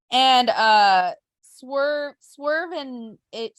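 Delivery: usual speaking voice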